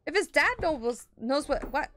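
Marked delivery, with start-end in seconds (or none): none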